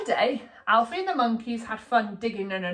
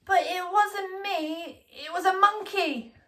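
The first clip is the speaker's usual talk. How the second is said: put-on high, slow, innocent child voice; little boy protesting; 9 semitones higher, slower than usual. A narrator's reading. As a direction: plead your innocence like a small boy.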